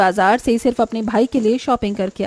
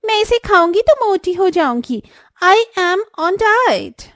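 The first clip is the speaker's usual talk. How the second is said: high, drawn-out fussy refusal; snooty refusal; 8 semitones higher, 4 dB louder, slower than usual. Acted. Elder sister-in-law Swati, turning down the sweets, in a high, drawn-out snooty voice. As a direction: wave the sweets away with a slow, high fuss.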